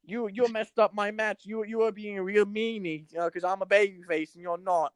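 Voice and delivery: high, mock British scold with a fake accent. Co-host mocks a wrestler calling out her rival in British accent. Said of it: "[name] shoving in his, uh, his accent talents".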